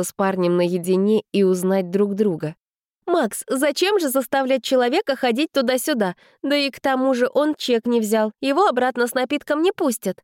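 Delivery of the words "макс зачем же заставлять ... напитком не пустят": put-on high girlish persuading voice. A narrator's reading. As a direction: coax him in a high voice. Vika persuading Max.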